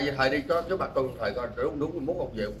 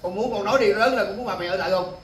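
louder and higher, coaxing the son to answer; 5 semitones higher, 5 dB louder than usual. Real, not acted. Man calls to a child.

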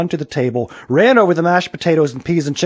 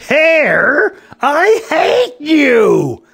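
furious high-pitched yell, drawn out; mad yell; 7 dB louder, much slower than usual. A narrator's reading. Aaron mad at his own hair, furious yelling. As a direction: yell it in a rage, loud and high. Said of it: "he got so mad that he yelled".

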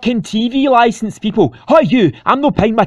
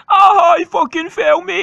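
pained yell; 7 semitones higher, 4 dB louder than usual. Acted. Character falls over.

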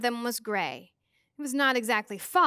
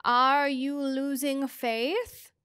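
very slow, stretched-out question; slow challenge; 2 semitones higher, much slower than usual. A narrator's reading. Mrs Which tests the children's faith, drawn out. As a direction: draw every word out, lifting at the end.

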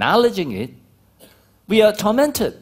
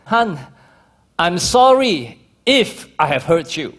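slow, loud hedging apology to a spouse; insincere apology; 3 semitones higher, 5 dB louder, slower than usual. Shown as a demonstration. Husband giving a fake apology to his wife, hedging. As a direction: say it like a dodge, not a real sorry. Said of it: "that is not confession".

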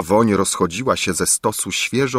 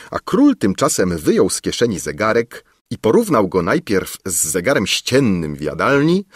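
ordinary delivery, nothing marked

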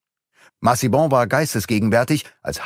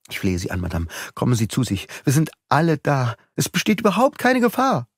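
pleading; 5 semitones higher, faster than usual. A narrator's reading. Beautrelet pleads with Madame.

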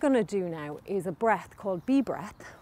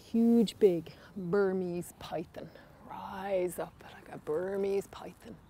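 whispering; 2 semitones lower, 4 dB quieter, slower than usual. Acted. Kids yoga, pretending to be python.

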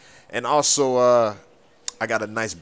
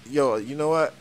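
normal talking voice

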